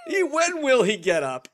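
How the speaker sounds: slow, high goofy whine; silly high voice. Pretend. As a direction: play it like a silly slapstick bit. Mock slapstick voice.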